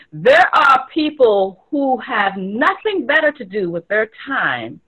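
ordinary delivery

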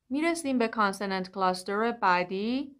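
ordinary talk

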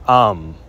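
'am' said as 'um', vowel before the m; pronounced am. Shown as a demonstration. Teacher corrects the masculine ending, 'am' with vowel first. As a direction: close 'am' on a held m. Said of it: "but it's pronounced".

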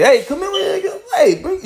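high, bright call to come over; high-pitched friendly summons; elders calling him over. Retold or imitated. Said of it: "And they was like".

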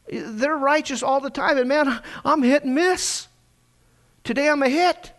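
normal talking voice

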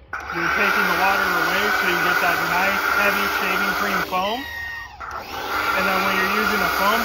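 loud and quick, pushing the voice over the roar of the washer. Real, not acted. Demonstrating the foam cannon over engine noise, loud and fast. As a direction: project the words over a running pressure washer.

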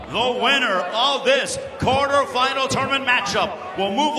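booming big-fight introduction; ring announcer. Acted. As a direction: announce it to the whole arena. Ring announcer opening the main event.

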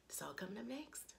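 soft unvoiced whisper, quick. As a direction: breathe the tease like a secret. Teasing the recap ahead.

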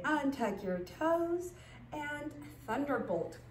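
normal talking voice

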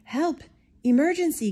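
high, loud emergency cry; urgent call. Acted. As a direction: shout for help as the doctor. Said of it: "called out the doctor".

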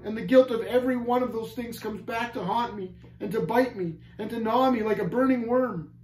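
high, agitated lament; trembling. A narrator's reading. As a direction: wail it as one haunted by guilt. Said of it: "began to tremble, saying".

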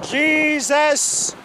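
ordinary delivery

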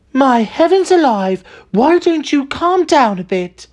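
high, drawn-out scolding; exasperated wife. A narrator's reading. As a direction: tell off an overexcited husband in a high voice. Wife scolds her overexcited husband.

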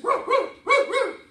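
high, loud howling woo; dog howls. Acted. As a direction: howl like a dog. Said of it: "but it sounded like this".

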